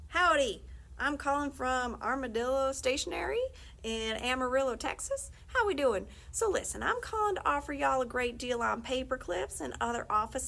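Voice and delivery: folksy Texan salesman pitching a deal; Texan salesman accent. Acted. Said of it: "somebody with a chipper English accent".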